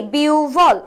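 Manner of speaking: "বিউভল" with a long biu, then a falling bhol; correct pronunciation. Shown as a demonstration. Teacher gives students the right answer, "বিউভল" drawn out as biuu-bhol. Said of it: "একের উত্তর কি".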